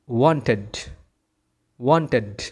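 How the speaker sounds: "wanted" with the e sounded; ED as full syllable. Shown as a demonstration. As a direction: say "wanted" as wan-ted. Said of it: "ই কে আমার উচ্চারণ করতে হবে".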